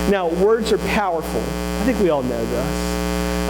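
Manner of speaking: normal talking voice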